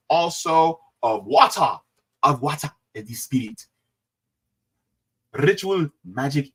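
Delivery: put-on general African accent; measured, playful African-accented announcement. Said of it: "general African accent".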